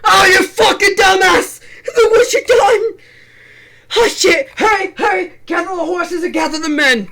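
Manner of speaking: loud, high, frantic yelling; panicked shouting. Acted. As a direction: scream it in a mad panic. Acted Humpty Dumpty panic scene.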